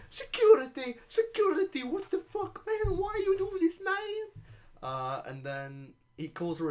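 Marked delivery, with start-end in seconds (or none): 0.1-4.4 shrill high yelling for security, much higher and louder; mock shrieking reporter. Streamer mimicking the reporter calling security, high falsetto shriek. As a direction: scream it like a panicked woman in a falsetto. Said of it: "she starts, like, going".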